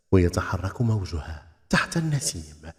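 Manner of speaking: ordinary delivery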